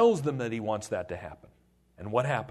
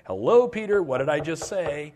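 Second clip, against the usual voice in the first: exasperated call; 2 semitones higher, 7 dB louder than usual. Acted. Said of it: "he's got a hard head".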